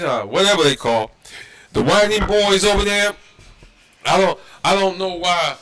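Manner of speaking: scolding the preachers who don't teach this; preaching. Acted. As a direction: press the congregation hard. Preaching with rising force.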